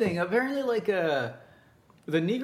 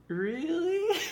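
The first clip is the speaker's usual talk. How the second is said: high-pitched, tickled mock surprise; giddy flirty squeak; 13 semitones higher than usual. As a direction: play up being flattered, squeaking upward. Mimics his own flirty, delighted reaction.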